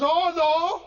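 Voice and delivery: Speaker calling out. Retold or imitated. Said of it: "as the Speaker in the British House of Commons do sometimes to get attention, he says".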